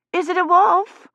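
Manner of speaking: put-on high child voice, wondering; wide-eyed child. A narrator's reading. Little girl asking Kivrin, wide-eyed child voice. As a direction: ask like a curious little girl. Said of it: "wide-eyed".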